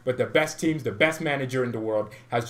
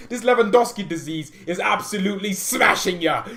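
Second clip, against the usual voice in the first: ordinary delivery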